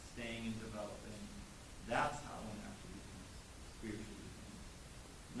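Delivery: usual speaking voice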